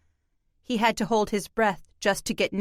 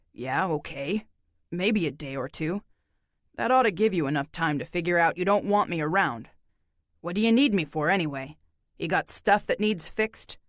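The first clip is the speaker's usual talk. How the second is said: lower, tired, laconic man; weary drifter voice; 4 semitones lower than usual. Acted. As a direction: speak low like a worn-out loner. Narrator voices John.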